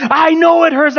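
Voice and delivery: dramatic retort. Retold or imitated. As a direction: yell back in a reenacted marital row. Reenacted shouting match with wife.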